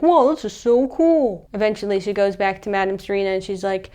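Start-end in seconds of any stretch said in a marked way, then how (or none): 0.0-1.5 higher, slow, wowed delivery; acted awe. Louise testing her new spells, acted awe. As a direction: stretch it out in mock wonder.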